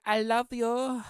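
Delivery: mocking a politician's put-on Latin accent; bad Latin accent. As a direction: overdo a clumsy fake Latin accent. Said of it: "she changed her accent to try to have a, a Latin accent".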